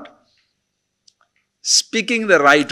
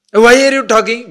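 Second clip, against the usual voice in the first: louder, higher demanding question; challenging question; 9 semitones higher, 8 dB louder than usual. Acted. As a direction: fire the question back at him. Acted teacher-student exchange.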